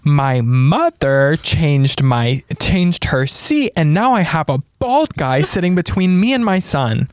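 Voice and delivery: imitating a distraught plea; mock tearful complaint. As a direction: draw it out like an upset daughter. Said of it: "you were like".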